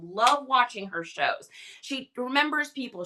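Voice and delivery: normal talking voice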